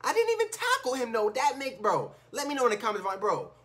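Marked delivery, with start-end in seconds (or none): none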